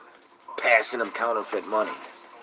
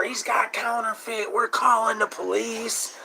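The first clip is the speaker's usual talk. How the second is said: much higher, mock screaming cashier; retold scream; 13 semitones higher than usual. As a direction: shriek it like the panicked cashier. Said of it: "they were screaming in the store".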